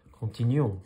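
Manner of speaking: loud call to keep going; rally the flock with a shout; shouted order. Acted. Old duck leader urging the flock onward, loud call. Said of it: "cria Clairon".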